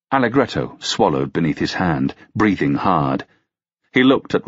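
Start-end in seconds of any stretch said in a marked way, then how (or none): none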